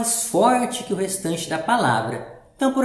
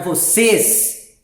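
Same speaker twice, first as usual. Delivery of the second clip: "vocês" with CÊS louder, closed e; stress on last syllable; 2 semitones higher, 4 dB louder than usual. Shown as a demonstration. Teacher reading the sentence to pupils, stressed last syllable. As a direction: punch the final "cês" of "vocês". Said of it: "ler com um pouco mais de força".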